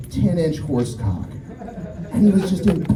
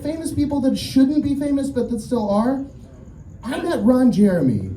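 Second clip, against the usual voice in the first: ordinary talk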